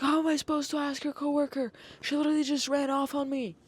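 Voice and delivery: high-pitched mock grandpa complaint; yelling grandpa. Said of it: "he keeps yelling at me".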